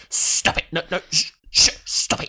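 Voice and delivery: forceful half-whispered hushing imitation; mock shushing. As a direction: shush her in mock fluster. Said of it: "And he's like".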